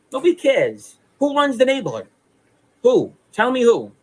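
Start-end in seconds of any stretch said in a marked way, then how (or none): none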